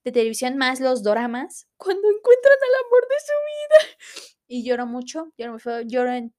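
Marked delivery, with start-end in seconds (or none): 1.8-4.0 high, loud fake crying; hammed-up tearful sob; mock sobbing. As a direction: wail it like a weepy drama fan. Yo lloro cuando veo.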